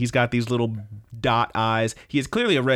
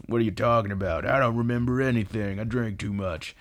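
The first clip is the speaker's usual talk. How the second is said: voiced Tone. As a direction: play Tone brushing off the apology. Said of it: "And Tone just goes".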